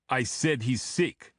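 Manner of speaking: put-on slow, insistent Russian accent; loud, accented. A narrator's reading. Bodyguard shutting down the agent's request, insistent Russian accent. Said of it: "Boris said loudly".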